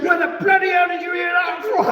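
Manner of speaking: shouting through laughter; a laughing mock yell of outrage; the furious Aussie, bellowed between laughs; retold angry shout. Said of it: "He said".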